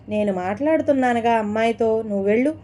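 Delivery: ordinary delivery, nothing marked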